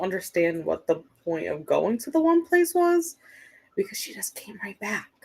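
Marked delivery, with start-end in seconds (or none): 3.8-5.1 whispered aside; confiding whisper to chat. Real, not acted. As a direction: drop to an unvoiced whisper. Gossipy aside.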